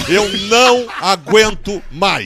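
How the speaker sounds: slow, loud, drawn-out cry of being fed up; half-joking wail of exhaustion, shouted slowly; exasperated outcry. Pretend. Repeats mock plea.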